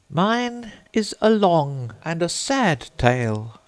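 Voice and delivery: high, slow, mournful mouse voice; a touch of a sigh, drawn out slowly; sighing, sad. A narrator's reading. Mouse sighing to Alice about its sad tale, slow and high. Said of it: "turning to Alice and sighing".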